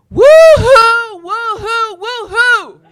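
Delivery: high, loud cheerleader whoop; cheer. Shown as a demonstration. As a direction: whoop it up for the crowd. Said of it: "I want you to go".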